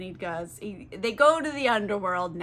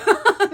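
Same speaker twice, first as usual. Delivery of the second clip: laugh; 11 semitones higher, 10 dB louder than usual. Real, not acted.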